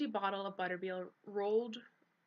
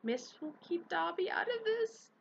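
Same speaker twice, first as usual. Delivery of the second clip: much higher elf voice, nervous; scared elf; 12 semitones higher, 3 dB louder than usual. A narrator's reading. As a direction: shrink away from the topic. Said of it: "Dobby mumbled".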